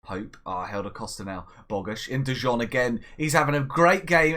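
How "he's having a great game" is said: louder, rising excitement; excited praise. Real, not acted. Manager watches striker attack, excited and loud. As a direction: praise him with a rush of joy. Second goal build-up.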